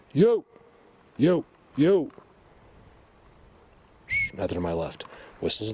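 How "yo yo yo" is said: loud, high-pitched shouts; short high hollers to someone at a distance; calling out. Real, not acted. Calling to the whistlers to show viewers, shouting out.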